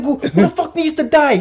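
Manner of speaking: high-pitched mock rage; acted-out vengeful outburst; enraged boss. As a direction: stammer out a high, frantic threat. Boss threatens revenge.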